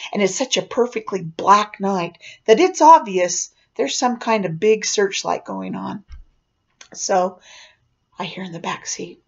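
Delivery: ordinary delivery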